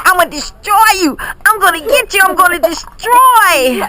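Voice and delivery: high, teasing "I'm gonna get you" voice, hammed up for a child; mock-menacing, like a tickle monster chasing a kid; playful monster threat. Shown as a demonstration. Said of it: "do this like".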